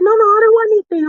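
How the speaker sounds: high, loud, fast no no; high loud quick mock refusal; imitating friend declining. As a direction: mimic a hurried polite refusal.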